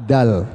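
"Dal" with the tongue forward, near the ta position; thin dal. Shown as a demonstration. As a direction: say "Dal" light and thin, not deep. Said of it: "Coba sebutkan dal di tempatnya tak".

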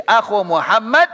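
"akhu Muhammad" with u ending akhu; case change. Shown as a demonstration. Teacher showing ending change, "akhu" with clear u.

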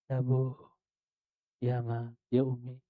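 soft, feeble answers like a sick man; limp weak voice. Retold or imitated. Preacher voicing Ibn Sirin answering his mother weakly. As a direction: speak faintly, as if ill for months.

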